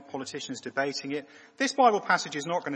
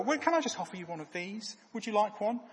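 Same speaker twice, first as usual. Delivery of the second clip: playful mock offer; 8 semitones higher than usual. Acted offering scone, higher voice.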